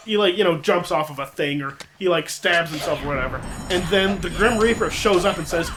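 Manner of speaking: normal talking voice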